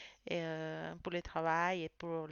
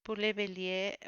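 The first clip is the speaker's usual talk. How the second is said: ordinary talk, nothing marked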